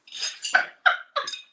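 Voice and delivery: unvoiced laugh, loud; a burst of airy laughter; breathy laugh. Real, not acted. Laughs at herself.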